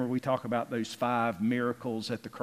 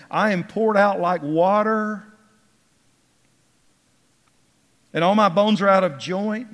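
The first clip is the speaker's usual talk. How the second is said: raised, ringing psalm recitation; impassioned pulpit reading, getting louder; fervent scripture reading; 4 semitones higher, 6 dB louder than usual. Acted. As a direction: declaim the psalm, building in pitch and volume. Reads the psalm aloud fervently.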